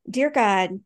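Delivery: usual speaking voice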